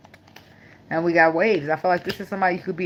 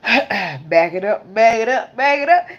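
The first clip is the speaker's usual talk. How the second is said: starts slow, then higher and louder each time; building back it up; 5 semitones higher, 6 dB louder, slower than usual. Real, not acted. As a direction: back it up, climbing up to a shout. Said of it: "let me just back the fuck up".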